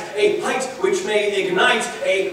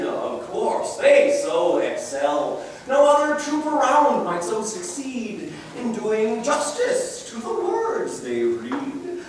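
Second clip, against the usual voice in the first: ordinary talk, nothing marked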